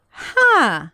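hammed-up narrator disbelief, long drawn out; Keith Morrison. Host mimicking a narrator's asides mid-story, drawn-out mock disbelief. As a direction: stretch the word into an incredulous swoop. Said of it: "not afraid to say".

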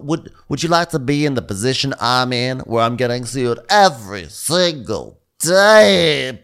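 usual speaking voice

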